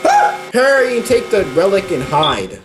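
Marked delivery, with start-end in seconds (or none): 0.0-0.5 sudden high shriek; startled scream. Real, not acted. Gamer hit by a jump scare, shrieking. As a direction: jump out of your skin.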